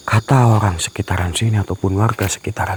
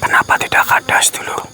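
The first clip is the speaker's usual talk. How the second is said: hushed whisper while searching; 9 dB quieter than usual. Real, not acted. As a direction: whisper warily to your viewers. (berbisik sambil mencari)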